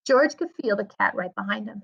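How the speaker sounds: plain reading voice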